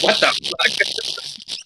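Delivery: slow breathless whisper; stunned whisper. Retold or imitated. Kid hearing the mom's insult, whispered shock. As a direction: gasp it out, stunned. His shocked reaction retold.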